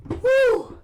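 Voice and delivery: high loud whoop. Real, not acted. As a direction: let out a big whoop. Shout through spice pain.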